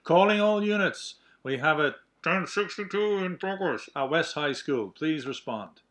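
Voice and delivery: dispatcher calling out over the radio; loud radio call to all units. Acted. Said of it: "he gets a call over the radio".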